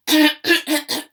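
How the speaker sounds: loud staged cough; throat-clearing cough. Shown as a demonstration. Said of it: "Let's get our voices ready".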